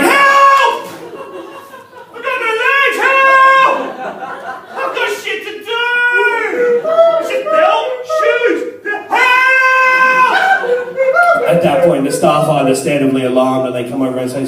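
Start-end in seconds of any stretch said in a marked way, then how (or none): 0.0-11.5 very high-pitched hysterical wailing; panicked cry for help. Acted. Man on acid who thinks his legs vanished, hysterical shrieking.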